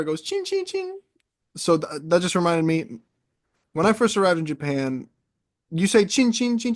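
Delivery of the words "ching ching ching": high ringing ching imitation; locker bell sound. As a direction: ring it out like a little bell. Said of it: "when you punch a locker".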